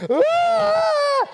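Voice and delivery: high, loud wail while running about; hammed-up frantic shriek; mock panicked scream. Poor mum running around the house in alarm, hammed-up scream.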